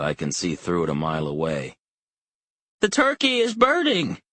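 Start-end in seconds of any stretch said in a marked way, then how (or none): 2.8-4.2 put-on much higher, louder frantic old woman's cry; panicked old mother. A narrator's reading. Elderly mother crying out from her easy chair, frantic. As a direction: shriek the alarm like a frail old mother. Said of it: "is exclaiming".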